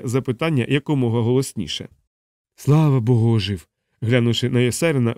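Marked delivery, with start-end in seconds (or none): 2.6-3.7 mild cry of relief; relieved exclamation. A narrator's reading. Pilot seeing his wounded friend alive, relieved exclamation. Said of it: "вигукнув Мак Простак".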